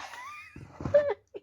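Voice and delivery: sudden shrill falling laugh; high-pitched squeal laugh. Real, not acted. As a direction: let out a tiny squeaky laugh. Laughs after opening jar.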